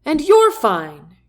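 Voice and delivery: loud, high and drawn out; joyful yell. A narrator's reading. General celebrates that Jack is fine, yelling. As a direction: yell it with delight, stretching the words. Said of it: "Granger yells".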